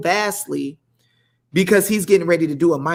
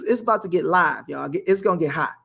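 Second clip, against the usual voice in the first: ordinary talk, nothing marked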